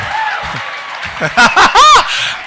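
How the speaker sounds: high, loud burst of laughter; real belly laugh at full volume. Real, not acted. Laughs loudly mid-sermon.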